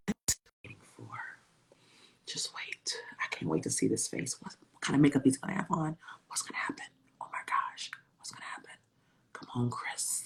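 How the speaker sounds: soft whispered excitement; hushed anticipation. Acted. Host awaiting Chris, hushed excited whisper. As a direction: whisper it like a secret.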